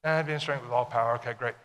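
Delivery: casual read-through, dropping away on "okay, great"; glossed-over reading. Shown as a demonstration. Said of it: "we read down through these verses".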